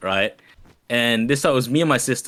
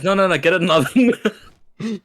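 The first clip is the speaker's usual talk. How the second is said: refusing patient, laughing; 7 semitones higher, 4 dB louder than usual. Retold or imitated. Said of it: "He goes".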